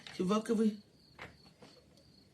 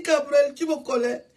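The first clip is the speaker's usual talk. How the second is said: ordinary talk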